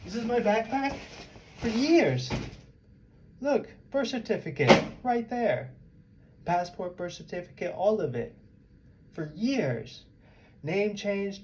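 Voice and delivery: high-pitched, agitated listing; real exasperation. Real, not acted. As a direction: drive each item home, pitched well up. Heated showing of carried documents.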